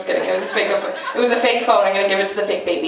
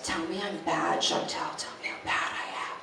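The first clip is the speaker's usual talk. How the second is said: much softer, low seductive murmur; mock sultry murmur; 3 semitones lower, 12 dB quieter than usual. Hookup in bed, asking softly and seductively. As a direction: purr it like a bedroom request. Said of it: "She's like".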